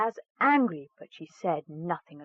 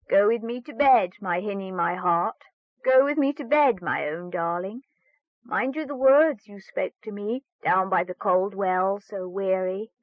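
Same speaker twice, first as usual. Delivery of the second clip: frog's coaxing plea; 4 dB louder than usual. Acted. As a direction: croon it like a pleading frog. Frog asks to bed.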